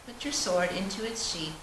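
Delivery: ordinary talk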